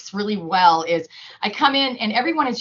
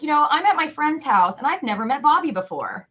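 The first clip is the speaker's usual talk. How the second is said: put-on higher, sing-song storytelling for kids; role-play excitement; 7 semitones higher, 4 dB louder than usual. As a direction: set up a pretend scene for children. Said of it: "do a little, like, role play".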